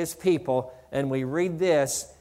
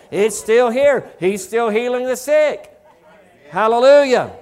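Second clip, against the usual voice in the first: raised, louder preaching voice riding high; full-force preaching; 5 semitones higher, 6 dB louder than usual. Acted. Preacher fires up the congregation with a healing story, loud and high.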